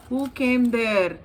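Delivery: usual speaking voice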